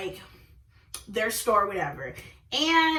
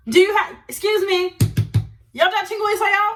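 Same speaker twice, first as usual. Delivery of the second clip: loud, high, rapid-fire interrogation; acting out an indignant grilling; confrontational demand; 7 semitones higher, 6 dB louder, much faster than usual. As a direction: demand an answer, raised voice and pitch. Acted confrontation over a girlfriend.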